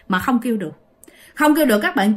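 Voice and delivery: ordinary delivery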